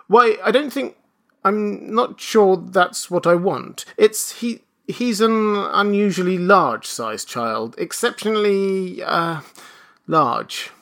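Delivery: high, halting excuse sinking at the end; flustered. Acted. Father explains child's size to clerk, flustered. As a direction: stumble awkwardly towards the last word.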